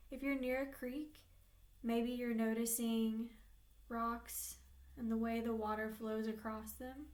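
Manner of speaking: unhurried meditation voice with long pauses; guided meditation. Real, not acted. Therapist leading clients into an inner peaceful place, guided meditation. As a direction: guide them gently, leaving space between phrases.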